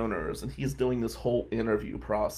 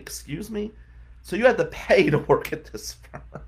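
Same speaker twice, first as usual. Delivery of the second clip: incredulous; 6 semitones higher than usual. Retold or imitated. Shocked at paying to work.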